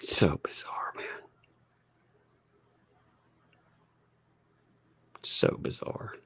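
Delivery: bored half-whisper. Real, not acted. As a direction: mutter it under your breath. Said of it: "this is so boring".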